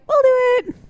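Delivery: put-on squeaky high girly friend voice, eager; chirpy friends. Friends eagerly offering to do the breakup, squeaky high. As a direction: pipe it up high like eager schoolgirls. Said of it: "they'll be like".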